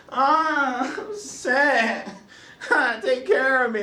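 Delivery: high-pitched, mock sickly whimpering; baby mode whine. Sick man to significant other, mock babyish whine. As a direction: whine like a grown-up playing helpless baby. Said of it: "went into that baby mode".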